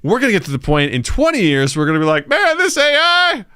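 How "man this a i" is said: put-on grumpy old man. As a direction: play a cranky old-timer who fears the AI. Future grumpy old self.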